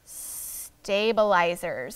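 slow, drawn-out teacher model; slow syllable model. Shown as a demonstration. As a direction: say it slowly for the class. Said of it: "Say stabilizers".

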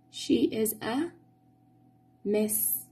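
ordinary talk, nothing marked

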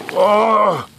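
high drawn-out "oh" of alarm; startled cry. Acted. Host cut off mid-sentence by mishap, startled cry.

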